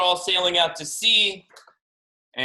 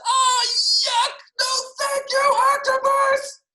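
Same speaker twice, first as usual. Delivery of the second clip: high, loud cry of disgust; loud, high-pitched revolted refusal; disgusted yell; 6 dB louder, slower than usual. A narrator's reading. Boy disgusted by paintbrush toothbrushing.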